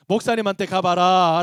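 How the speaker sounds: loud, drawn-out send-off; mock teachers' call. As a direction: call it out slowly and loudly, imitating teachers. Teachers passing the complaint on.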